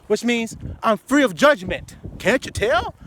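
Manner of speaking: ordinary talk, nothing marked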